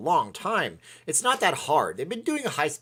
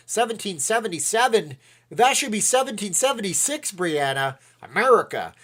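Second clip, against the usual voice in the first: raised pitch, louder; excitedly reading the chat; 8 semitones higher, 6 dB louder than usual. Real, not acted.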